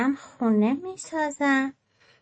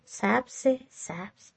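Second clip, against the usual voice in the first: softer and softer, dying away; fading echo; 6 dB quieter, much slower than usual. A narrator's reading. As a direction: let the word drift off gently. Echoing the word softly.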